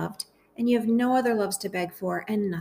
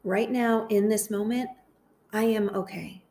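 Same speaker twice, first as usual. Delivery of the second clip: guided meditation. Acted. Host praying phrases aloud for listeners to repeat, guided meditation. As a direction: lead them gently through it, phrase by phrase, unhurried.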